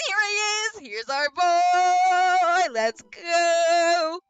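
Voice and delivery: loud, high, drawn-out hype shout; hyped cheer. Pretend. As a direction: hail the arriving buddy with huge, long vowels. Loud hyped cry at Craig's arrival.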